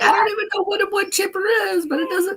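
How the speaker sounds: squeaky rushed bewilderment; fast high mock confusion. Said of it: "He's like".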